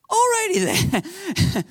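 high-pitched, comically startled reply; comic bemused reaction. Retold or imitated. Pastor reacting to teammate's visions, comic bemusement. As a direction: jump up high in mock bemusement.

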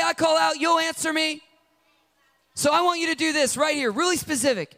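high, loud insistent demand; demanding prayer. Retold or imitated. People cry out to God, high loud demands. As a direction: order God about.

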